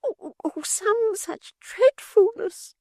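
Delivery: very high, slow, mock hysterics; a prude squealing in alarm; Miggs, hysterical. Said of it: "said Miggs hysterically".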